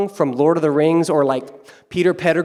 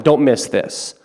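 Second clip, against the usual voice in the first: ordinary delivery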